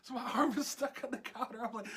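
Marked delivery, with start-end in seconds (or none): none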